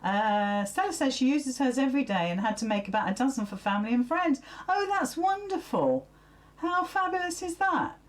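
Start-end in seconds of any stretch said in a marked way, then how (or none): 4.7-8.0 high, gushing delight. Real, not acted. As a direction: gush over the good news. Reacting warmly to a viewer comment.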